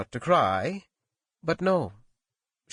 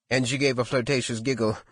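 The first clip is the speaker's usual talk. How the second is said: ordinary delivery, nothing marked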